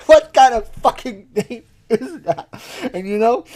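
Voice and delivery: incredulous, laughing, loud. Real, not acted. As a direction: shout it while laughing. Laughing disbelief at name.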